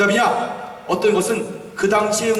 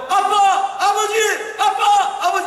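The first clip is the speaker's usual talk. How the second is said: high, loud cry of Abba Father; 13 semitones higher, 3 dB louder, slower than usual. Shown as a demonstration. Preacher showing the congregation how to cry out, loud and high. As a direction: call out to God like a child to his dad. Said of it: "한번 해봐요".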